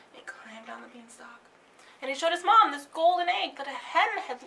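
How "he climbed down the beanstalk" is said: hushed whispered narration. A narrator's reading. Narrator tells of Jack sneaking down, whispering.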